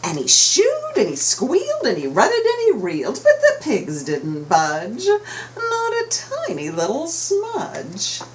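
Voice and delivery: put-on lilting, expressive read to children; storybook narration, big pitch swings; read-aloud storytelling. A narrator's reading. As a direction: sing the rhyme to the kids. Rhyming narration.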